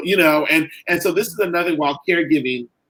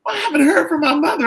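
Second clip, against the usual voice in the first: much higher, pleading and upset; imitating distressed mother; 14 semitones higher, faster than usual. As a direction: voice mom anxiously asking for her parents.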